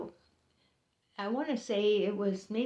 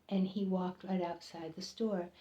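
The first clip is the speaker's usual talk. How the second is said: ordinary talk, nothing marked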